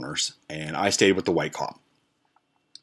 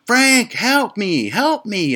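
very high, slow wailing call; imitated grandmother crying for help; 4 dB louder, much slower than usual. Grandmother calling from the truck to grandson, imitated high yell. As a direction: mimic a drawn-out, high plea for help. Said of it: "And she's going".

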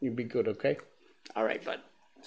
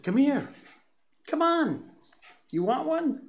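high dog-calling voice; 14 semitones higher than usual. Real, not acted. Coaxing the dog.

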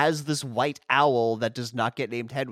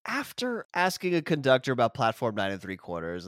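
ordinary talk, nothing marked

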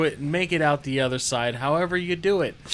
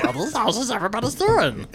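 fast, rasping creature voice; scurry through it like a goblin; Gollum-like voice; 6 semitones higher, faster than usual. Acted. Trying out the new voice, fast Gollum rasp. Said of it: "Should I just talk like that".

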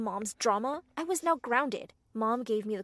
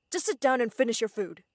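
louder, firm parental order; stern; 8 dB louder than usual. A narrator's reading. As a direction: order a child like a stern father. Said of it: "Dad said sternly".

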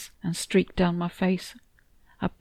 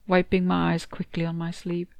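ordinary delivery

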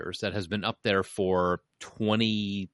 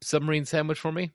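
higher, playful customer plea; mock customer request; 7 semitones higher than usual. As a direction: ask like a cheeky regular. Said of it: "It's like".

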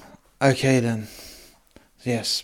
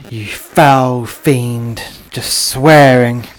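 louder, slow then rising, scolding the demon; angry rebuke; 7 dB louder, slower than usual. Acted. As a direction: stand firm and berate the fiend. Priest confronts the demon.

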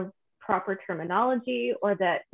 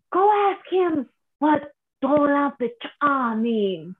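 higher, slow retold instruction; prankster aunt; 6 semitones higher, 4 dB louder, slower than usual. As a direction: imitate an aunt setting up a prank, drawn out. Aunt's prank retold, acted out.

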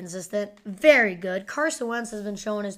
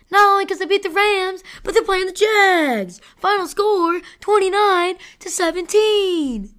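loud, high excited rant; fired up and shouting the picks; hyped; 8 semitones higher, 9 dB louder than usual. Real, not acted. To viewers, celebrating the Niners' win in a loud, high voice.

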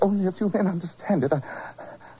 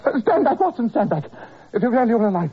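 high, loud, panicked warning; frantic shout; 5 semitones higher, 4 dB louder than usual. Acted. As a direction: shout him back in alarm. Sick Holmes warding off Watson.

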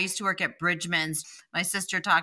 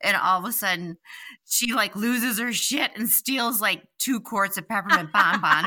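ordinary talk

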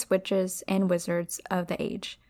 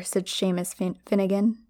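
ordinary talk

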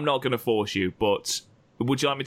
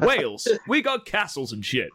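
high-pitched tourism pitch, played for laughs; mock tourism slogan; 6 semitones higher than usual. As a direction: sell it like a daft tourist advert. Mock tourism advert for Wales.

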